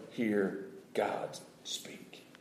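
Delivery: slow hushed whisper. Acted. Preacher hushing the church over God's silence, whispered. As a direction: breathe it, very slow. Hushed dramatic sermon moment.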